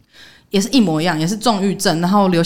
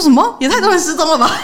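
high, loud incredulous cry; shocked disbelief; 11 semitones higher, 6 dB louder than usual. Retold or imitated. Coworkers hearing his girlfriend also vanished, shocked and loud. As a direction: gasp it out in disbelief at the news. Coworkers react to another disappearance.

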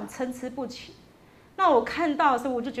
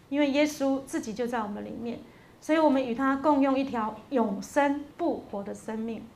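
normal talking voice

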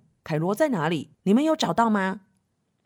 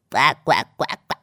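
duck quacks; 8 semitones lower, much faster than usual. Acted. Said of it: "小班也是这样子哦".

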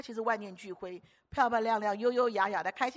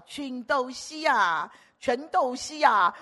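slow, louder drawn-out calling; imitating old mother; 3 semitones higher, 10 dB louder, much slower than usual. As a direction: call out like an old Hong Kong mother. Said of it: "她叫我". (模仿老妈妈慢慢叫唤)

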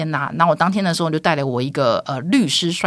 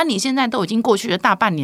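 ordinary delivery

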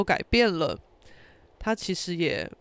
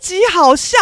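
delighted exclamation; 13 dB louder than usual. Real, not acted. Reacting to friend's video, gleeful shouting. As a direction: stretch it out in loud delight.

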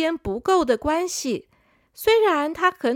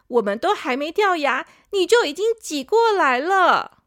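higher, firm big-sister telling-off; angry scolding; 5 semitones higher, 3 dB louder than usual. Acted. Canine sister scolds rude younger tooth, firm. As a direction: scold him for being rude. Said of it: "犬齿姐姐生气地说".

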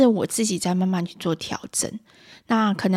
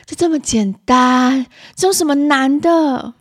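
loud, slow, high exasperation; irritable mother; 5 semitones higher, 6 dB louder, slower than usual. Acted. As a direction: act a mum scornful that it seems hard. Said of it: "暴躁的妈妈会反问孩子说". (暴躁妈妈拖长音大声反问)

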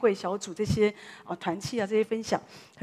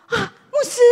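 high, loud, delighted cry of recognition; excited call; 13 semitones higher, 8 dB louder than usual. Retold or imitated. Tour guide spotting the pastor, high excited shout. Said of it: "我就听到个声音说".

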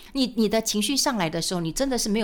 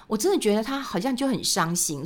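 ordinary delivery

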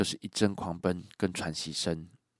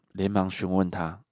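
usual speaking voice

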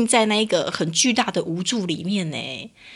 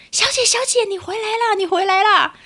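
much higher, calling out across the counter; calling after someone; 12 semitones higher than usual. Retold or imitated. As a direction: clerk hurrying to call her back. Clerk calling her back. (高声喊住她)

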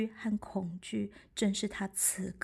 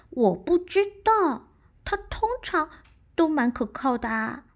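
high, quick little squirrel voice; chirpy small animal voice; 7 semitones higher, 5 dB louder than usual. Acted. Squirrel character voice.

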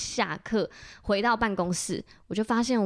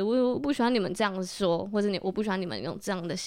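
ordinary talk

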